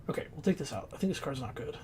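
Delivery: normal talking voice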